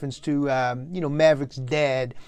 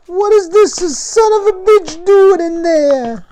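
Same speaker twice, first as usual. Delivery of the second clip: shrill, far higher, much louder angry mother; furious mom screaming down the hall; yelling old mother; 13 semitones higher, 11 dB louder than usual. Acted. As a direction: holler like an outraged old Italian mom. Mom yelling at son from other room.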